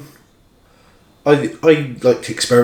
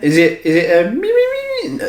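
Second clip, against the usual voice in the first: squeaky high me me me; shrill Beaker-style meeping; Cousin It voice; 10 semitones higher than usual. Retold or imitated. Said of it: "How does Cousin It sound".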